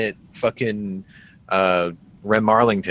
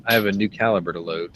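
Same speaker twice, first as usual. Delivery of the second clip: ordinary talk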